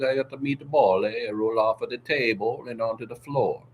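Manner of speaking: hammed-up Italian accent with tacked-on eh; mock Italian accent. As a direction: play the comic Italian telling a meatball tale. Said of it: "was really enjoying my Italian".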